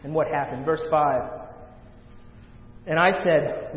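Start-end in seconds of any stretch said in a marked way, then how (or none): 2.9-3.7 loud, impassioned scripture reading; full-force preaching. Acted. Preacher voicing Isaiah before the holy King, full force. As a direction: proclaim the prophet's words with force.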